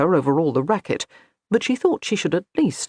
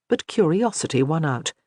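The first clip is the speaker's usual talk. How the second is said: ordinary delivery, nothing marked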